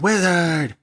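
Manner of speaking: high loud shout. Retold or imitated. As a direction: shout it out together. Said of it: "shout out".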